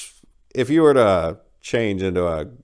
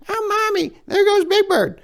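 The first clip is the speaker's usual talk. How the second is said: put-on very high, childlike squeal; a kid tugging at Mommy, high and thrilled; excited little kid; 5 dB louder than usual. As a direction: play a small child pointing in delight. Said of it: "the little kids will be yelling".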